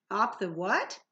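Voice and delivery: ordinary delivery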